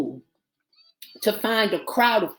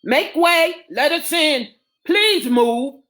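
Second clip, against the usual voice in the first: higher, urgent command; 7 semitones higher, 5 dB louder than usual. A narrator's reading. Doctor rushing up the stairs, commanding. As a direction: clear the way with a raised voice. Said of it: "Doc David commanded".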